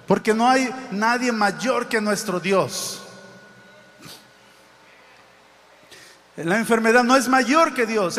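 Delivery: loud, rising preacher's proclamation; full-voiced sermon climax; fiery preaching. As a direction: proclaim it to the whole church.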